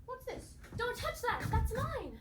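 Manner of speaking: snatch it back with alarm; startled, protective. Acted. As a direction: cave girl protecting her belonging from the stranger. Cave girl guards her object.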